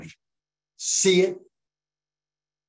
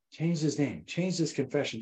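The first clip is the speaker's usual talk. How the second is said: ordinary delivery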